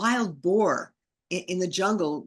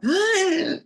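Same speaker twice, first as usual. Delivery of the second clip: high, loud involuntary gasp; a sudden weird squealing gasp, imitated; demonstrated gasping screech; 12 semitones higher, 5 dB louder than usual. Shown as a demonstration. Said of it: "try to approximate what it sounded like".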